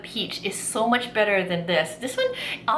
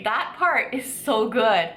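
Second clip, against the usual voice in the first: normal talking voice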